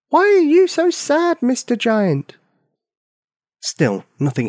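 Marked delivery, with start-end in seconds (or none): 0.1-2.4 high loud yell up at a giant; child's yell. Acted. Little girl yelling up to the giant. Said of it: "She yelled again".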